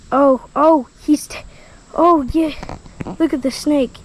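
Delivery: ordinary talk, nothing marked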